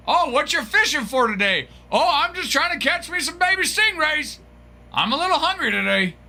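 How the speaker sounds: high-pitched silly fisherman voice; mock fishing chat. Streamer mocking a viewer out fishing for stingrays, silly high voice. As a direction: ham up cheery small talk, way up high. Acts out fishing small talk.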